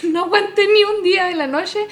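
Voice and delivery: laughing it out, high and loud. Real, not acted. Telling the friend about failing to last a night, laughing. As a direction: laugh through the line.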